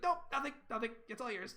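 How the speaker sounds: high-pitched, cowed refusal; hasty giving-in. Acted. Daughter under mother's squeezing grip, high and cowed. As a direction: squeak out that you want nothing.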